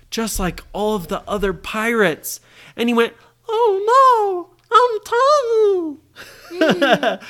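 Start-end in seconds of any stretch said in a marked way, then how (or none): 3.5-7.2 tiny squeaky voice. Acted. Captain finding he has been shrunk, tiny squeaky voice. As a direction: wail like a shrunken little captain. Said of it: "Because he was so small".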